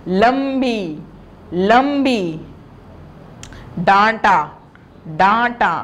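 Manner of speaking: measured teacher dictation, pauses between words; slow, evenly spaced classroom recitation; word-by-word reading drill. Shown as a demonstration. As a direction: model each word for pupils to repeat. Models word list for pupils to repeat.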